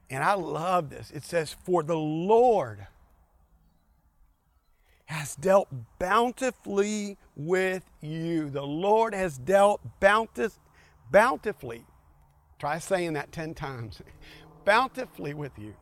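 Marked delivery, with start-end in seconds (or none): 1.6-11.8 slow emphatic reading. Shown as a demonstration. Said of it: "drawn-out scripture reading".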